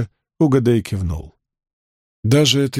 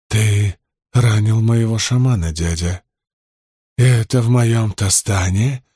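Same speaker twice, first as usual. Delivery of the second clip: growled warning. A narrator's reading. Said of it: "прорычал Угадей".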